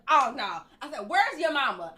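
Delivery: loud, indignant parent demanding answers; retold yelling. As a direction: call out loudly, imitating an angry mom. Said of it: "I'm yelling, people looking".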